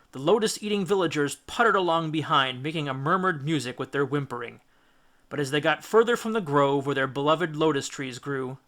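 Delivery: plain reading voice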